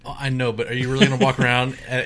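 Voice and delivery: usual speaking voice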